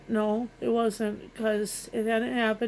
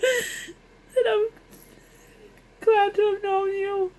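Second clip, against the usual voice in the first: crying; 10 semitones higher, 4 dB louder, slower than usual. Real, not acted. Farewell to dead husband, voice breaking.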